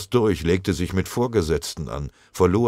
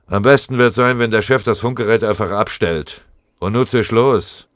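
loud, higher, brusque order; angry dismissal; 5 semitones higher, 9 dB louder than usual. A narrator's reading. Sergeant sending the runner away, loud and angry. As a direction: send him off with heated impatience.

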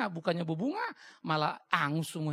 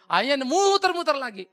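loud, higher, laughing through the words; laughing loudly; 9 semitones higher, 14 dB louder than usual. Real, not acted. Pastor laughing as he tells the congregation of the liar's slip, loud and high. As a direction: laugh out loud while telling it.

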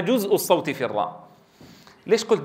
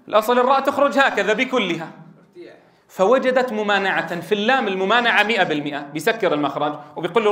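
ordinary delivery

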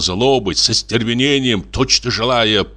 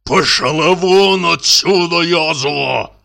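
hissed; 8 semitones higher, much slower than usual. A narrator's reading. Clerk to the old woman, a slow hissed order. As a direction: spit it at her through the teeth. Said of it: "прошипел Алексей Николаевич".

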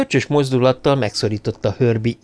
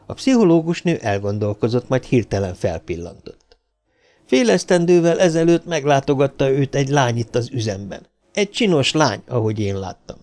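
ordinary delivery

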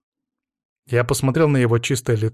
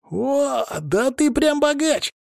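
put-on very high, drawn-out boyish wonder; boy exclaiming; 13 semitones higher, much slower than usual. A narrator's reading. Boy marvels at the man's food, high exclamation. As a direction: exclaim slowly and high like an amazed kid. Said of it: "воскликнул малец".

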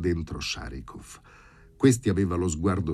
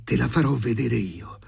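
raised, spiteful threatening; vindictive, higher-pitched warning; hateful threat; 6 semitones higher, 4 dB louder than usual. A narrator's reading. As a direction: threaten them with open hatred. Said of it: "disse all'improvviso con odio".

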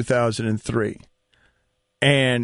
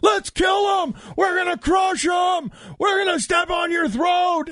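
dugout yelling. Retold or imitated. Players yelling threats at the Yankees from the dugout, shouting. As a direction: bellow threats at the other team. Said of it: "starts yelling in the dugout".

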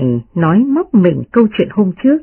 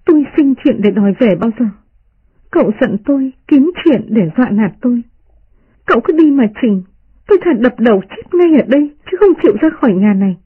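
crying protest; 6 semitones higher than usual. Acted. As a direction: cry out in hurt defiance. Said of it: "Tỉnh Văn khóc lóc".

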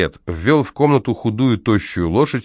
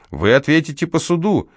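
indignant threat; 8 semitones higher than usual. A narrator's reading. House manager threatening in the quarrel.

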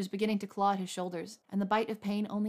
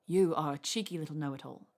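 lower, husky tease; 4 semitones lower than usual. A narrator's reading. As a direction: growl it fondly at the know-it-all. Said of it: "he said huskily".

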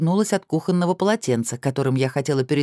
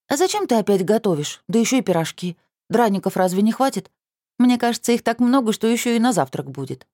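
put-on higher, playful teenage girl; teasing teen girl; 8 semitones higher than usual. A narrator's reading. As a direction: tease like a cheeky niece. Niece voiced.

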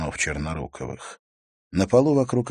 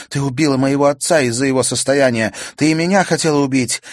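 furious accusation; 8 semitones higher, 8 dB louder, faster than usual. A narrator's reading. Natalya accuses the witch.

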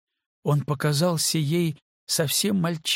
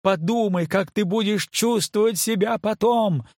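high, loud desperate plea; shouting; 7 semitones higher, 4 dB louder than usual. Acted. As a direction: yell it at him, pleading. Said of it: "Кейт сорвалась на крик".

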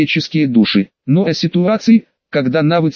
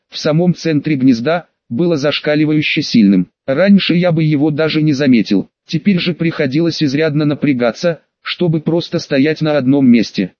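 usual speaking voice

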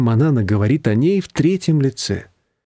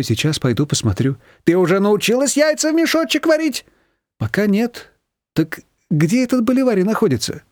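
higher, busybody voice; nosy neighbour; 8 semitones higher than usual. A narrator's reading. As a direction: quiz Nina about her chores. Neighbour Rima chats.

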